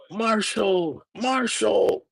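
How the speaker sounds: high, drawn-out mock announcer call; announcer call impression. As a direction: call the name like a highlight reel. Repeating announcer imitation.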